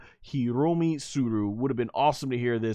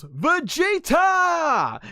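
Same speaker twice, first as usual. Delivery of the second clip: long, high drawn-out yell; yelled name; much slower than usual. Retold or imitated. Bulma yelling Vegeta's name at the fight, long high yell. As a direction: scream his name across the battlefield. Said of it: "And she yells".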